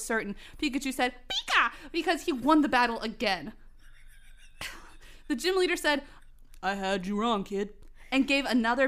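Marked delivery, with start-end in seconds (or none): 1.3-1.7 high-pitched little creature squeak; Pikachu. Acted. Voices Pikachu's cry. 6.6-7.7 low-pitched gym boss; conceding gym leader. Acted.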